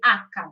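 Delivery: "H" said AK-ka, in two syllables; Italian H. Shown as a demonstration. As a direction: insist on "H" as acca. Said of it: "It has to be H".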